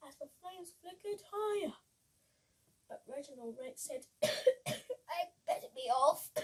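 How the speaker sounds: put-on lively read-aloud for children; bouncy rhyming picture-book voice; storybook reading. A narrator's reading.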